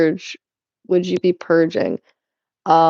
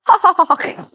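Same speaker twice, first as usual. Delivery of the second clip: high, put-on villain cackle; hammed-up evil "ha ha ha"; evil laugh; 9 semitones higher than usual. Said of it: "That was an evil laugh".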